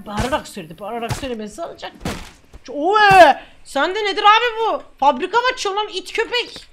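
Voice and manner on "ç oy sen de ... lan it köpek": high, raised outburst; outraged scolding. Pretend. Officer berating smuggler over a big haul, outraged scolding. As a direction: explode at the smuggler in role.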